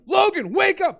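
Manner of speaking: high, loud urgent call; freaking-out shout. Retold or imitated. Panicked friends rousing Logan, freaking-out shout. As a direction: shout to rouse someone, alarmed. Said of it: "They start freaking out".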